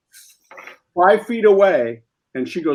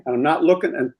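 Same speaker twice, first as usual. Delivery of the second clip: ordinary delivery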